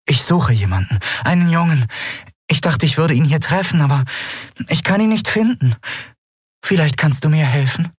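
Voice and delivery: put-on high, lovely young woman's voice; warm, sweet, desperate undertone. A narrator's reading. Lost young woman approaches a stranger, warm with desperate undertone. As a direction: plead sweetly, with despair underneath. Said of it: "trotz ihres verzweifelten Untertons so warm und lieblich".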